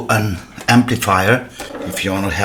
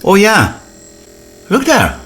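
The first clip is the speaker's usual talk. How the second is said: sudden high, loud delighted cry; thrilled the machine finally moves; excited outburst; 12 semitones higher, 5 dB louder than usual. Real, not acted. Excited cry as the old motor spins.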